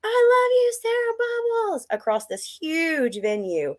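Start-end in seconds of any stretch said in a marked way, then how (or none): 0.0-1.9 put-on high-pitched child calling out from the crowd; squeaky little fan's shout; little kid fan. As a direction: imitate a tiny voice cheering, much higher and louder. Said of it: "I hear this little voice say".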